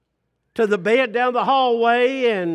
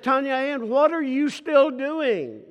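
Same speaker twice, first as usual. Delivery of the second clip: mildly frustrated dad; 6 semitones higher than usual. Retold or imitated. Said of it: "She knew by the tone of my voice that I was a little bit frustrated".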